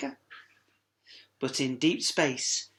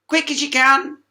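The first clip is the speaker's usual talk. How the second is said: hurrying character; 10 semitones higher, 8 dB louder than usual. Acted. Character urges, high loud.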